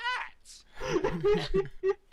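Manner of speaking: high, loud laughing fit; long real cackle. Real, not acted. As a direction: crack up helplessly at the punchline. Cracks up at own story.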